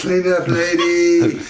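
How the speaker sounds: high, loud and slow mock dog bossing the lady around; bossy dog voice. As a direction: speak for the dog, ordering her to clean up. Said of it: "Boo said".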